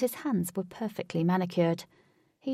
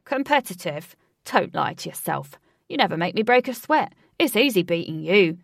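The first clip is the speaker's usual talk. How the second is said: cocky taunt; 7 dB louder than usual. A narrator's reading. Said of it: "Ajax mocks Huey".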